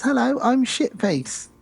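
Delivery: high, slow, innocent little voice; Glenn introducing himself. Retold or imitated. Doll greeting his parents, high and innocent. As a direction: say it sweetly like a shy child. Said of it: "And he says".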